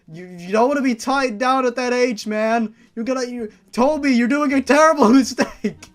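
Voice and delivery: loud, high yell at the character; mock outrage. Real, not acted. Reader yelling at Toby not to marry, loud and high. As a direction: shout a warning at the page.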